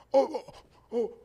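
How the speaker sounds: put-on confused drunk caught off guard; drunk rambling uncle. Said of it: "drunk as hell".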